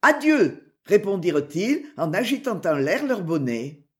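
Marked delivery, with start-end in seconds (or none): 0.0-0.8 high, loud goodbye called back; called-out farewell. A narrator's reading. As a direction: call back across the distance. Group calls back a farewell. (adieu lancé haut et fort)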